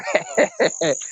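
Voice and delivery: a sudden high, soft giggle. Real, not acted. Host amused by a listener's name, high giggle. Laughs at "Al Alabama.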